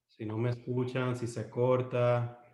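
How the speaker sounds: ordinary talk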